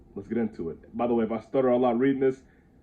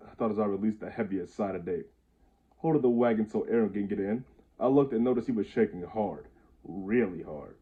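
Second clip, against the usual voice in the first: ordinary delivery, nothing marked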